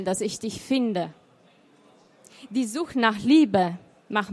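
measured recital with pauses between the lines; poem recital. Acted. Poet reads her poem to the listeners, lightly measured. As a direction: let the refrain "Ich renne gegen die Zeit" carry the poem. Reading announced poems aloud.

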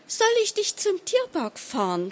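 very high, naive question; wide-eyed. A narrator's reading. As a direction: ask it innocently, pitch way up. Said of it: "fragte ich mit großen Augen".

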